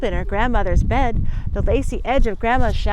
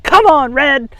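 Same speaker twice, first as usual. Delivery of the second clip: louder urgent call; 4 semitones higher, 10 dB louder, slower than usual. Acted. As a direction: call her to follow. Grandma calls the girl.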